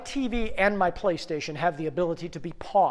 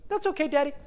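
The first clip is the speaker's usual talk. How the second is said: high-pitched small child, imitated; little daughter voice; 10 semitones higher, much faster than usual. Three-year-old forgiving her dad, sweetly high imitation. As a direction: lift the voice high like a forgiving toddler.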